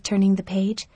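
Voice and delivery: plain reading voice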